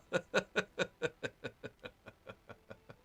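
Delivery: real laugh; amused laugh. Real, not acted. Amused by game quote.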